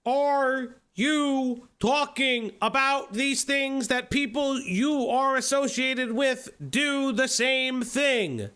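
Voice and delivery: high, slow, drawn-out incredulity; exasperated disbelief. Real, not acted. Host asking Jar about associates doing the same thing, exasperated. Incredulous rant at Jar's hypocrisy.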